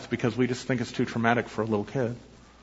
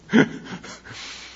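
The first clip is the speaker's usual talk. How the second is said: helpless laugh at his own story; real laugh. Real, not acted. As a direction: let the laugh trail on after the punchline. Laughs after his own punchline.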